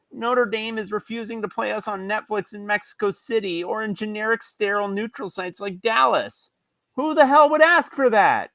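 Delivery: high, whining complaint; whiny voice. Acted. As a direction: read it like a sulky whiner. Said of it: "Whiny voice".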